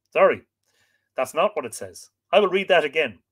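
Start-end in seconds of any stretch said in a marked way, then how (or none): none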